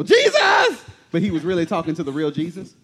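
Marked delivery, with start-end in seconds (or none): 0.0-0.8 high amazed cry of the name; amazed exclamation. Retold or imitated. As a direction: gasp it out in wonder. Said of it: "Exactly what you say when you see something that's incredible".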